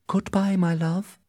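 put-on high-pitched young lover's farewell; high, tender-hearted heroine saying farewell; lovelorn Juliet. A narrator's reading. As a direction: voice Juliet parting from her love, pitched well up. Juliet bids Romeo farewell from balcony.

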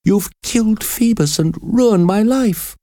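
high, accusing outcry; crying out. A narrator's reading. Prisoner blames priest for her ruined life, crying out. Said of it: "Esmeralda cried".

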